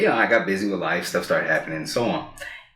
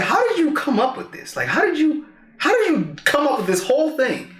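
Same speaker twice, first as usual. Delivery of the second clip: high, marveling question; lightly voiced amazed grandpa. Grandpa asking how he came up with it, high and marveling.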